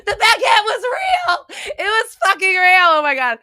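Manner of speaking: shrieking high and loud while laughing and half crying; laugh-crying shout. Real, not acted. Real laughing shout. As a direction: streamer yells that her baguette vision was right, laughing. Her baguette reading proved right.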